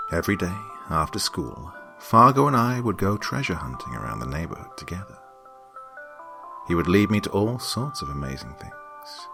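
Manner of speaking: usual speaking voice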